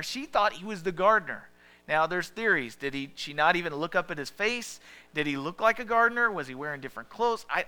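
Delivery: ordinary talk, nothing marked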